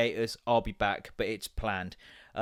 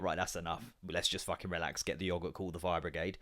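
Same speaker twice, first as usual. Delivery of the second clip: mock panicked aside; 7 semitones lower, 9 dB quieter than usual. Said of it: "been like".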